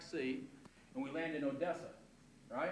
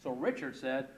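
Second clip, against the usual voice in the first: ordinary talk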